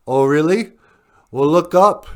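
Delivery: high, loud, cocky comeback; smug retort. Retold or imitated. Val Kilmer taunts the cop with smug bravado. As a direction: toss it off like you hold all the cards.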